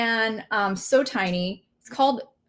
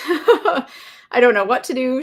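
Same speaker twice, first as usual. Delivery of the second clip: nervous laugh through words; 3 semitones higher, 6 dB louder, slower than usual. Real, not acted. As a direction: laugh while admitting helplessness. Laughing at own panic.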